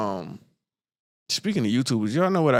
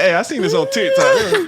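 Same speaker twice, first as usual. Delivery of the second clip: very high, loud, laughing imitation; mock naive customer; 6 dB louder than usual. Gullible customer telling the bank, high mocking voice. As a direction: squeal it like an eager believer.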